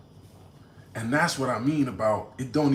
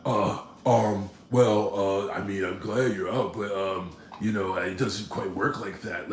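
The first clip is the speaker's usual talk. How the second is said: lower, hesitant stammering lawyer voice; mock the lawyer squirming for excuses; flustered lawyer; 5 semitones lower, slower than usual. Lawyer caught out on the phone, low fumbling stammer. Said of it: "And he's like".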